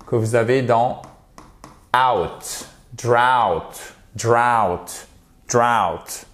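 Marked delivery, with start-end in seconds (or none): 2.0-6.3 "drought" repeated with the ou of out; "drought" rhyming with out. Shown as a demonstration. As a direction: model "out", then "drought" with the same vowel, spaced out. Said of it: "C'est le même son que vous avez".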